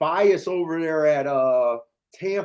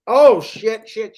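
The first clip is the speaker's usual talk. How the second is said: sudden swear; 6 semitones higher, 9 dB louder, slower than usual. Real, not acted. Sudden reaction to the race.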